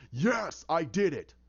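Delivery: acted angry admission; heated outburst. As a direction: burst out with it, cutting in. Bruce turns and bursts out.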